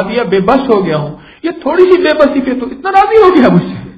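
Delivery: loud, high, impassioned preaching; preaching at full force. Acted. Preacher telling the crowd how a father wept, preached at full force. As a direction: drive every line home to the crowd. Praising God's mercy to crowd.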